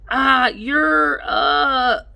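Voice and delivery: high strangled cry, cut short; a kid faking a knocked-out wail; pained cry. Acted. Boy pinned to the floor fakes a hurt cry.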